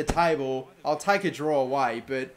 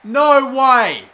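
loud, higher, slow drawn-out outcry; frustrated shout; 9 semitones higher, 16 dB louder, much slower than usual. Real, not acted. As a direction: yell at the screen in disbelief. Conceding penalty, frustrated.

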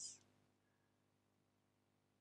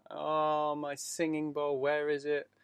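mock distress; 5 semitones higher, 6 dB louder, slower than usual. Acting out losing singing bowl.

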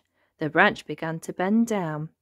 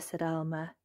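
ordinary delivery, nothing marked

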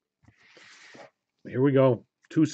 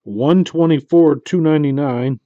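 excited number call; 4 semitones higher, 8 dB louder than usual. Real, not acted. Numbered card reveal.

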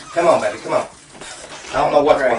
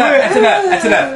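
high, loud, laughing shout; 11 semitones higher, 6 dB louder than usual. Real, not acted. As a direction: shout stop over the chaos. Father stops seance amid chaos.

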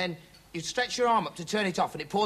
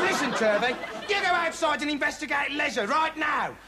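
high-pitched, worked-up command; angry boss's order; 7 semitones higher than usual. Acted. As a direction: order him out, voice raised high. Boss gives an order.

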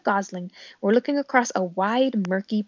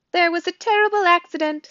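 high, loud, incongruously cheerful; chirpy robot voice; 9 semitones higher, 5 dB louder than usual. A narrator's reading. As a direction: announce it brightly as a friendly robot. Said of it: "does your voice sound so cheerful".

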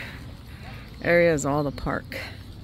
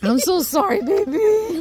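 soothing the dog; 13 semitones higher, 4 dB louder, slower than usual. Real, not acted. Comforting dog after near fall.

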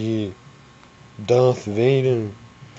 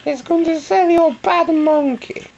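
Grandma voice; 11 semitones higher than usual. Pretend. Grandma selling her monkey to a buyer, high granny voice. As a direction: squeak it out like a fussy grandma hawking a monkey. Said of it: "I'm Grandma talking".

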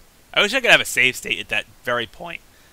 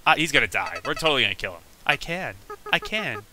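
normal talking voice

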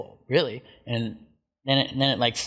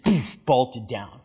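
ordinary delivery

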